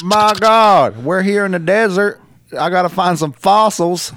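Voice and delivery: high, loud hammed-up commentator impression; Jim Ross. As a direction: cry it out like an overwrought announcer turned adventurer. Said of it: "you can see Jim Ross in".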